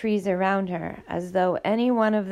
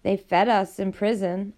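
usual speaking voice

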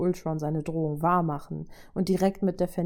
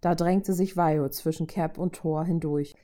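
ordinary delivery, nothing marked

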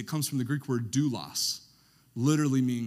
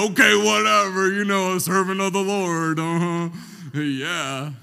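loud, dismissive uh-huh; sarcastic spouse; 4 semitones higher, 9 dB louder than usual. Pretend. Spouse texting back, loud and sarcastic. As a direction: brush it off mockingly. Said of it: "They would be like".

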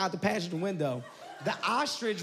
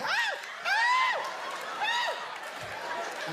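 yelping in a water panic; panicked screams; much slower than usual. Retold or imitated. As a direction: scream 'Ah!' in sudden panic, way up high. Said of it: "And I panicked".

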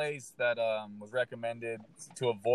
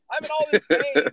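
much higher and louder through a laugh; laughing complaint; 8 dB louder than usual. Real, not acted. Comedian laughing as he retells his back pain, laughing. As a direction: crack up while griping about the pain.

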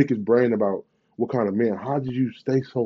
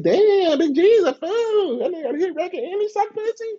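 imitating a woman. A woman laughing at the rapper, high mocking voice. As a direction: play her laughing in disbelief. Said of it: "She'd be like".

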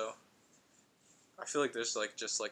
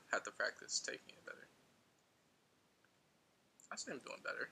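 usual speaking voice

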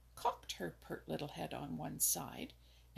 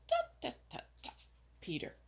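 sharp scolding voice; 5 semitones higher than usual. A narrator's reading. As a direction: scold him like a sharp little bird. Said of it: "in her sharp, scolding voice".

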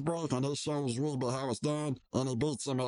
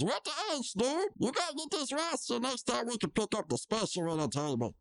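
mock Mordecai; 10 semitones higher than usual. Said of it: "Mordecai says".

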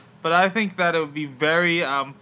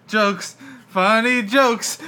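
higher, drawn-out hammed-up delivery; slow, raised-pitch self-mockery; mock joking; 5 semitones higher, 4 dB louder, much slower than usual. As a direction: play up how funny it supposedly is. Deflects being called out for reused joke.

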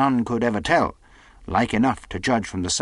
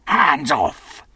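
louder, higher snapped warning; sharp cry; 5 semitones higher, 5 dB louder than usual. Acted. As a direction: bark it, leaping back. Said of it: "cried Silver".

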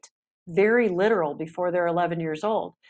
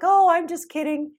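higher-pitched mock "just kidding" tone; imitated sarcastic brush-off; 9 semitones higher, 4 dB louder than usual. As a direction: imitate a parent brushing off their own sarcasm. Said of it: "we're frustrated and we get sarcastic".

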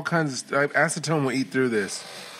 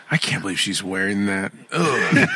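mock disgust; 4 semitones lower than usual. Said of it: "Here's a go-to trick that guys use".

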